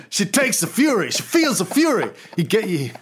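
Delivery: cocky Fury bragging to the team; Nick Fury voice. Retold or imitated. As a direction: preach it to the boys. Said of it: "Nick Fury was like".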